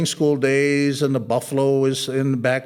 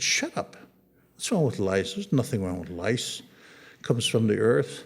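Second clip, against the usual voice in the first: put-on older brother's low scolding; 5 semitones lower, 5 dB quieter than usual. Older brother scolding crying little brother.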